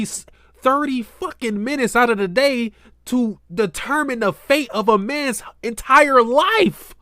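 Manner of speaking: high-pitched, indignant protest; outraged rant. Real, not acted. Player protesting to the judge over a man's fate, outraged. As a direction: plead the case in mounting outrage.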